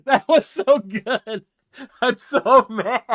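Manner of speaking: words squeezed out through laughter, slow; breathless laughing aftermath. Real, not acted. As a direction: giggle the words out.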